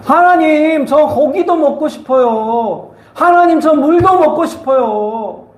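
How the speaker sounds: high, drawn-out mock whine; complaining to God. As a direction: plead like grumbling people, stretching the endings. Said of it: "원망했어요". (하나님께 투정하듯 높고 길게 원망하는 흉내)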